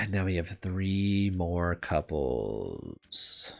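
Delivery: slow, soft, drawn-out groan of words; weary dread. Real, not acted. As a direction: drag it out, sinking with resignation. Dreads the remaining story routes.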